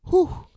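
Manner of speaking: high, loud "whew" of relief; sigh of relief. Acted. Viewer stepping back from a hard life, relieved whew. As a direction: blow out a big relieved whew. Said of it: "step back and say".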